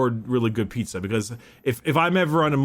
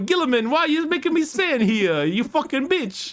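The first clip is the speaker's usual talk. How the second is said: much higher, laughing mock Italian rant; mock Italian Lorgar; 11 semitones higher than usual. Lorgar cursing at Guilliman in a high mock Italian voice. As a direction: ham up a furious Italian Lorgar.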